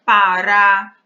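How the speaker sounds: pará said loudly, weight on final rá; stress on RÁ. Shown as a demonstration. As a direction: drive home the last syllable of pará. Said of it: "também, o rá é pronunciado com mais força".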